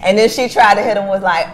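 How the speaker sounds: ordinary delivery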